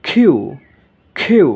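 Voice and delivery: "কিউ" loud, as khiu with a puffed k; corrected letter Q. Shown as a demonstration. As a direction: punch the breathy k of "কিউ", falling. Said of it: "এর মতো কিছুটা". ("কিউ" জোরে, খ-ধ্বনিতে)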